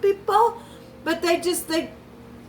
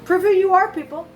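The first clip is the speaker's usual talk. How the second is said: higher and louder, calling out; loud challenge; 6 semitones higher, 4 dB louder, faster than usual. Real, not acted. Reader challenging the audience, loud and high. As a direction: throw the challenge at the listeners.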